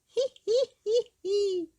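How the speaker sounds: put-on high, loud childish giggle; giggling. A narrator's reading. Two little girls giggle at baby Peppa. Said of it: "Susie and Peppa giggled".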